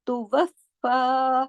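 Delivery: तुवफ़ा slowly, the fa vowel held two beats; तुवफ़ा with shadda joined and the last vowel held long; stretched vowel. Shown as a demonstration. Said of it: "दो हरकत".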